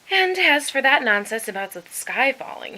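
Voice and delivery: loud mocking sneer. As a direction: scoff at the chick's nonsense. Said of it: "he sneered".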